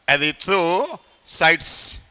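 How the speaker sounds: slow dictation. Shown as a demonstration. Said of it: "बोलो भैया नाम।".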